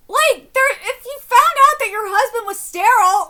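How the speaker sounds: loud, laughing outrage; laughing rant. Real, not acted.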